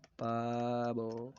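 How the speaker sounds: पाबो with very long vowels; drawn-out repeat. Shown as a demonstration. Teacher repeats the peacock's name for students, drawn out. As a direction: stretch पाबो right out.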